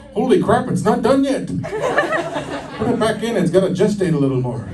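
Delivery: put-on a doctor panicking over a blonde baby; mock panicked doctor. Doctor panics at blonde newborn, high mock panic.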